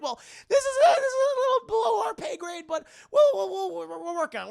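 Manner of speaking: very high, loud stammering excuse; mock evasive fluster. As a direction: imitate someone squirming out of a promise. Stark dodges with hurried excuse.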